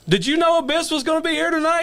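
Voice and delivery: high, incredulous question; high-pitched disbelieving question; amazed question. Retold or imitated. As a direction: ask it high and stunned. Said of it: "And I'm like".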